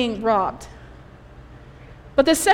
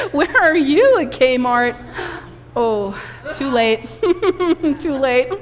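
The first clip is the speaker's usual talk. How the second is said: laughing. Real, not acted. Laughing with yelling audience.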